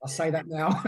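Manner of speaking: breaks into a high laugh after the line; chuckling admission, then a burst of laughter. Real, not acted. Husband jokes about being back with his wife, laughing. Joking that they reunited.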